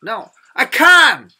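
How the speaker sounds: "can't" loud and high with the t dropped; American can't. Shown as a demonstration. Teacher showing how Americans say no, emphatic American "can't". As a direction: say "can't" big, no final t. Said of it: "미국에서는 요렇게 말하죠".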